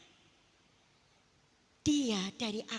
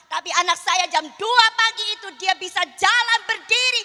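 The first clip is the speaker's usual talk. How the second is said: loud, high, triumphant preaching; full-force preaching; 10 semitones higher, 19 dB louder than usual. Acted. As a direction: proclaim the miracle to the congregation. Preaches her testimony. (khotbah berapi-api dengan suara keras)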